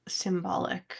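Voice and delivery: breathy whispered admission; whispering. Acted. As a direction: drop to a whisper, a little embarrassed. Admitting awkward ritual detail.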